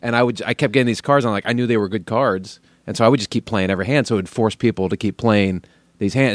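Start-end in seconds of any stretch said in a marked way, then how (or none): none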